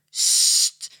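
unvoiced hushing sst; shushing. Mia shushes Katja during her TV programme, hushing. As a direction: shush her sister sharply. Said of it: "zegt ze".

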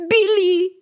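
loud, high call for a lost pet. A narrator's reading. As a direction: shout the name across the room. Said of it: "roept Lola".

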